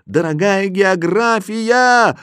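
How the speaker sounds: high, slow cry for help. A narrator's reading. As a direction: call out to Geography. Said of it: "и я крикнул".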